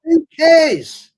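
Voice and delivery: high, loud dismissive yell; incredulous outburst; scoffing shout. Real, not acted. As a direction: throw it away with a loud scoff. Repeats scoff louder.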